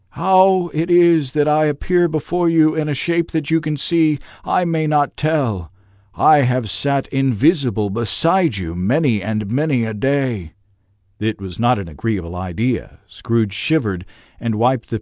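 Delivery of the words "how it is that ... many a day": a tormented spirit confessing; Marley's ghost reproaching himself; grieving ghost. A narrator's reading. Ghost speech.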